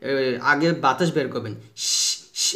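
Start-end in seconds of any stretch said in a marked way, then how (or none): 1.9-2.5 শ as a long voiceless hiss. Shown as a demonstration. Teacher models the sound of shin, hissing শ. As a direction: let only air out on a sustained শ. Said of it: "এভাবে শব্দটা বের করবেন".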